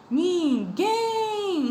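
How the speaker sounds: Ninguém, holding guém long and high; drawn-out last syllable. Shown as a demonstration. Teacher drawing out guém for pupils to repeat. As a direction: stretch out guém so the class hears it. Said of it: "Em qual a tia demorou mais?".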